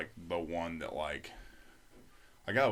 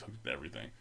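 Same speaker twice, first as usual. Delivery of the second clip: ordinary delivery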